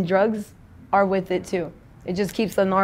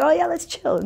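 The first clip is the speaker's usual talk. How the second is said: much higher, breezy mock bro voice; pitched-up casual imitation; 10 semitones higher than usual. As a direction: toss it off like a friendly bro. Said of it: "They'll be like".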